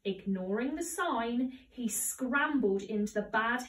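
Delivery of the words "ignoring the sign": ordinary delivery, nothing marked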